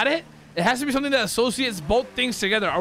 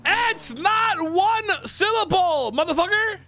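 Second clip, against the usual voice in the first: exasperated insistence; 5 semitones higher than usual. Real, not acted. Player arguing syllables with friend, exasperated. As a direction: hammer each word in indignant disbelief. Heated argument about syllable count.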